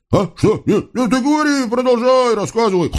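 high, flustered devil cover-up; high, jumpy flustered voice; flustered fake innocence. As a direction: caught out, cover it up quickly.

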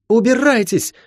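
high, fierce cry; high-pitched defiant command; angry order. Acted. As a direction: drive them out with a high cry. Girl orders intruders out.

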